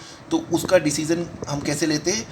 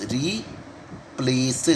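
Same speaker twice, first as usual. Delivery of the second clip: Replace stretched very slowly and softer; slow soft drawn-out word; 3 semitones lower, 5 dB quieter, much slower than usual. Shown as a demonstration. Teacher writing the outline, slow soft replace. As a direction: draw out replace softly as you write it. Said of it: "teacher draws the word out".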